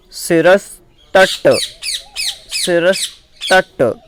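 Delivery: शिरस्तट said slowly, syllable by syllable; slow syllable-by-syllable recitation. Shown as a demonstration. Teacher sounding out शिरस्तट slowly for learners. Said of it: "फिर से उच्चारित कराऊंगा".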